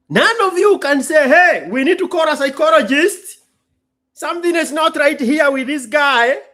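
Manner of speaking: loud, high mock concern; mock alarm. Voicing the audience calling for a psychologist, loud and high. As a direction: play the worried audience.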